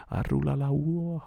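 very high, very slow drawn-out howl; keen like a grieving bear; bear's wail. Acted. Baloo wails over losing Mowgli, slow high howl.

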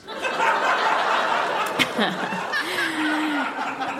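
long helpless giggle; embarrassed laugh. Real, not acted. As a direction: crack up at the diary. Laughing at own diary.

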